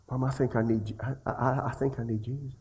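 soft, halting little confession; imitated timid child. Small boy confessing to his mother, quiet and halting. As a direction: hesitate and restart, very softly.

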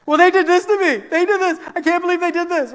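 high-pitched mock whining complaint; tattletale kid. Kid reporting on the other kids, tattling. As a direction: tattle like an outraged child. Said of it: "the tattletale nature of kids".

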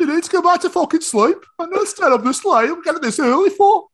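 very high, loud Brummie-Yorkshire indignation; muddled Brummie-Yorkshire accent. Acted. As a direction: berate him in a botched Brummie-Yorkshire voice. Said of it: "That was like a Brummie mix of a Yorkshireman".

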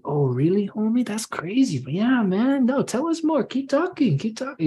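mock sweet, menacing friendliness. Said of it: "They're like".

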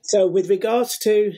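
ordinary talk, nothing marked

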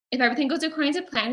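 normal talking voice

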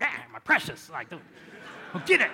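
cling to it like it's your treasure; mock Gollum. Congregation clutching their idol, mock Gollum snarl. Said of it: "you're like".

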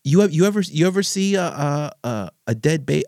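high, loud mock yell of the father; retold yelling. Son imitating his father yelling about war, high and loud. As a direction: hurl the question like the angry father. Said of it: "He was just yelling at me".

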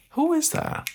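put-on high, puzzled old woman's voice asking who that is; an old woman startled by her own reflection, asking high; confused old lady.

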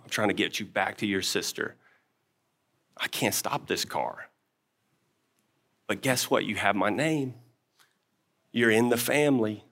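put-on calm a crying child with love; softly reassuring his baby son from the front seat; tender fatherly reassurance. Father soothing son in car.

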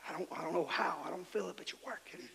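unvoiced whispered prayer; tearful schoolboy's whispered prayer. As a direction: whisper it as a hurting child. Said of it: "whispering under my".

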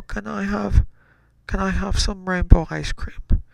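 put-on high-pitched little kid asking for a treat; high, childlike request; pleading child. Child begging parent for treat.

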